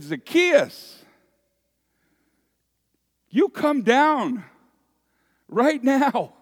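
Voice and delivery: high, loud call up into a tree, slowing on "you come down". Acted. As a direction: call him down commandingly, like Jesus summoning Zacchaeus. Jesus calls up into the tree.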